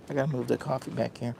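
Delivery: ordinary talk, nothing marked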